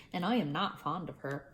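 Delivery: usual speaking voice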